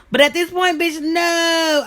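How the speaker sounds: loud, high, laughing shout of no; emphatic refusal. Retold or imitated. Refusing the woman hitting on her, loud and high.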